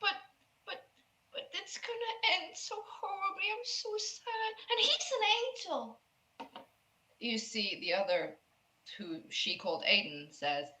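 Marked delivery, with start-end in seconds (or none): 0.0-6.0 put-on tearful girl. Sad girl protests to Aiden about her angel, tearful and high. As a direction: whimper the protest in a tiny high voice. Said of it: "crying and holding him".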